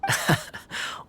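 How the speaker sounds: a short breathy chuckle; quiet amused chuckle; soft laugh. Acted. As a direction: laugh lightly under your breath. Amused reaction in conversation.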